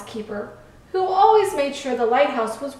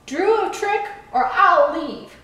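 higher, louder mild threat; insistent; 5 semitones higher, 4 dB louder than usual. A narrator's reading. Boat pushing the lighthouse to perform, insistent. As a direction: press the demand with emphasis. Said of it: "the boat insisted".

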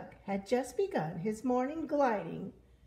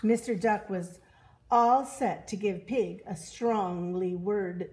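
plain reading voice

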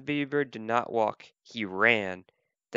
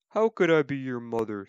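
lightly put-on cow voice; cow character. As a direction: play an incredulous storybook cow. Cow answers baby bird in story.

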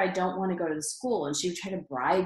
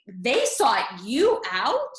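slow, louder, disbelieving echo; incredulous mom; 4 semitones higher, 5 dB louder, much slower than usual. Retold or imitated. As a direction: stretch it out in disbelief. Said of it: "she was in shock".

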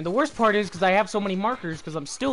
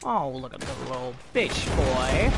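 put-on hillbilly accent; 6 semitones lower, 4 dB quieter than usual. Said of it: "do my fucking hillbilly accent".

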